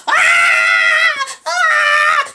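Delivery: high, loud, sustained shriek; imitated scream of pain. Heroine stung by the wipe, screaming in pain. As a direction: scream two long ahs. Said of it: "and she's screaming".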